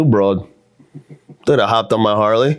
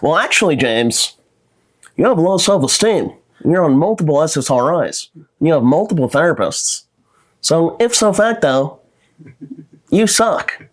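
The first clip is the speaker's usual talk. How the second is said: higher, smug lecturing know-it-all; 6 semitones higher than usual. Retold or imitated. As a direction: talk down to James like a know-it-all. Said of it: "the know-it-all pussy".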